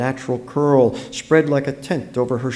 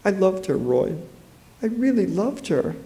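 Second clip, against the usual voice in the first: mildly tearful, higher voice; 7 semitones higher, 4 dB quieter than usual. A narrator's reading. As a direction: confess it with a touch of grief. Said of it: "see the tears blurring her eyes".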